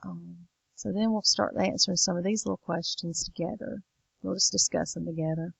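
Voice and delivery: usual speaking voice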